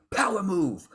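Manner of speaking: big, high hype-man shout of power move; hyped power move. Retold or imitated. Mimicking his old self as a loud seminar hype man. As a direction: pump it up like a self-help guru. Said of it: "I was trying to be like".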